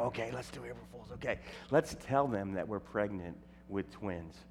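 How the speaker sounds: hushed plotting; conspiratorial. Retold or imitated. Couple plotting an April Fools prank, hushed. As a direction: whisper the prank plan. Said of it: "Ann and I go".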